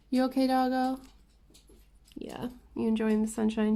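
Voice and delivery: high, sweet dog-talk voice. Real, not acted. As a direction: coo at the pet. Talking to her dog.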